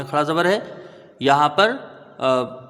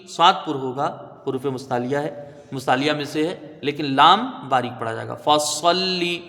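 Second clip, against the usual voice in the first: ordinary delivery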